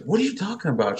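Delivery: high-pitched baffled comeback; protesting innocence. Retold or imitated. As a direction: imitate a husband acting clueless. Accused father playing innocent.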